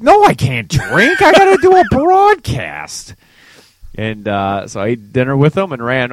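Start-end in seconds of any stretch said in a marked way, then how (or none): 0.0-3.1 mock outrage, climbing high and loud; indignant retort. Son refusing dad's drink offer, hammed-up indignant yell. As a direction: protest loudly, voice rising to a squawk. Retold indignant reply to dad.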